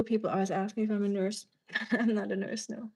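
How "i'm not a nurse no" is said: ordinary talk, nothing marked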